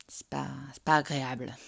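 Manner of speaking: talking through braces; slurred by a dental appliance. Real, not acted. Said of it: "ça fait des trucs comme ça".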